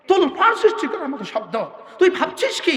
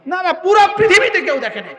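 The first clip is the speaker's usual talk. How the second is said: higher, louder cry of denial; preacher's shout; 6 semitones higher, 3 dB louder than usual. Real, not acted. Preacher to congregation, shouting that no one has seen it. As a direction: call it out to the whole hall.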